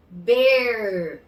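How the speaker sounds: "ber" slow, with a held R; drawn-out final R. Shown as a demonstration. Teacher explaining why we say it, drawn-out R. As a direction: sustain the growled R in "ber". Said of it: "É por isso que a gente fala".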